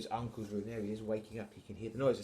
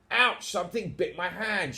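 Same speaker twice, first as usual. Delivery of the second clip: higher, pained exclamation; startled yelp; 7 semitones higher, 5 dB louder than usual. Acted. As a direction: yelp as a bitten burglar, mildly hammed-up. Burglar bitten by pirates.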